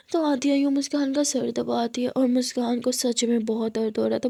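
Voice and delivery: ordinary delivery, nothing marked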